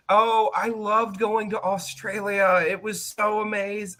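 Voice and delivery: high, drawn-out imitation of gushing; mock gushing. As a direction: imitate a returning student raving, slowly. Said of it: "talk about just how amazing it was".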